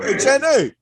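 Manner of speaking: high, loud holler to someone off in the back; mock yell. Host calling his girl Jenny in the back, playful yell. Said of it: "I was yelling at my girl".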